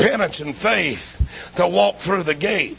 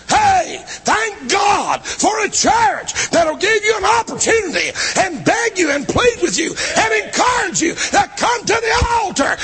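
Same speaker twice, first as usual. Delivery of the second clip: full-force preaching; 12 semitones higher than usual. Acted. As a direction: preach it at the top of your voice to the congregation. Sermon climax, full-force preaching.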